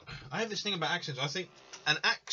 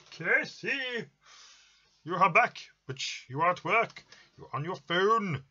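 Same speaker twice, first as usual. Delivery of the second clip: high, loud mock-scolding call; 8 semitones higher, 7 dB louder than usual. Real, not acted. Streamer to returning friend who should be working, loud high call-out. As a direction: call out loudly to a friend, pitched well up.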